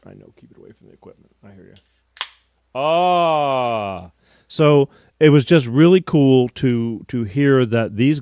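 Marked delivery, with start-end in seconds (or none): 0.1-1.7 barely audible off-mic mutter; faint whispered aside; hushed aside. Real, not acted. Hushed aside while opening can. 2.8-4.1 long refreshed sigh after a sip; savoring post-drink exhale; satisfied "ahh". Real, not acted.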